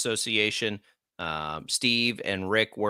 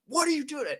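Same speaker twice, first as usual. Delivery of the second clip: incredulous mock scream at a teammate; imitated scream. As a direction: yell it in retelling, voice leaping way up. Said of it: "I'm screaming at her".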